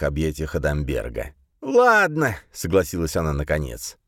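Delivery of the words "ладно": reluctant agreement. A narrator's reading.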